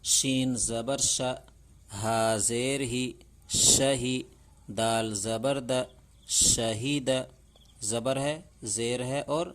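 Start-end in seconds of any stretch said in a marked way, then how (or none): 0.0-7.1 louder, slow syllable-by-syllable reading; loud, slow Urdu reading drill; loud drill recitation. Shown as a demonstration. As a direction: call out each letter so the class can follow.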